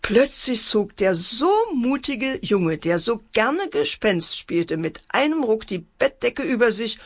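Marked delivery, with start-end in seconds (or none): none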